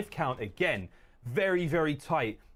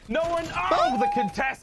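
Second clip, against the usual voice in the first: surprised caster call; 6 dB louder, slower than usual. Real, not acted. Caster reacting mid-fight.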